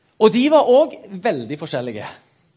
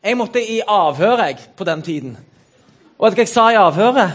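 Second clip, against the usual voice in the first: ordinary talk, nothing marked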